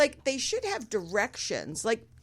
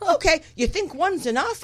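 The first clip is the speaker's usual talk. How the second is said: higher-pitched, lively retold friend; friend's questioning reply; 7 semitones higher than usual. Friend answering her about taking a gummy, questioning. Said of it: "And she's like".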